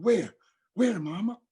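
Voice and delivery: put-on high, eager little child; child's voice. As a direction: answer like a trusting small kid. Child looks in trunk.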